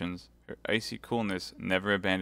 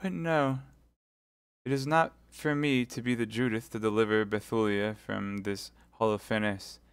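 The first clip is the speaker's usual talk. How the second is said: weakened, suppliant; 3 semitones higher than usual. Acted. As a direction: give up the mission with feminine weakness. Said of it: "as if the weakness of the woman overpowered the enthusiasm".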